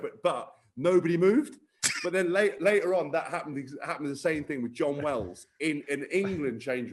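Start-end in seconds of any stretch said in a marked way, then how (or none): none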